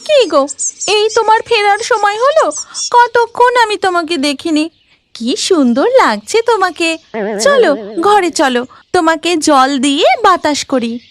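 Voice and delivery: disguised ghost wife. Acted. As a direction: coo over him like a doting wife. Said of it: "মালাবতীর ছদ্মবেশে শাকচুন্নি".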